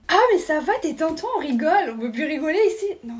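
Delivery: ordinary talk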